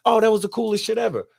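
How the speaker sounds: imitating her thrilled gush; excited rave. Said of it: "And she was really excited".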